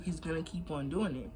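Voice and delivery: ordinary delivery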